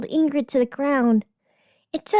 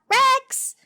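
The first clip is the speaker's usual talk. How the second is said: sharp high call across the room; loud high call to a distracted co-host; 11 semitones higher, 3 dB louder than usual. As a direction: grab his attention with a loud high shout of his name.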